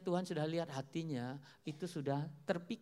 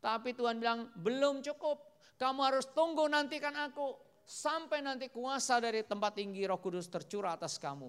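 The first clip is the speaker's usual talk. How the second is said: raised, high-pitched pulpit voice; forceful preaching; 7 semitones higher, 5 dB louder than usual. Acted. Preacher tells how God said to wait, forceful preaching. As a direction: proclaim it to the congregation.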